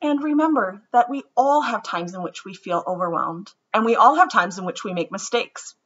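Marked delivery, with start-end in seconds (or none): none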